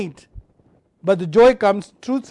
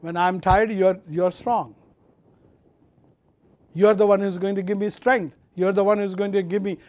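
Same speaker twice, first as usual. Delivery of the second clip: usual speaking voice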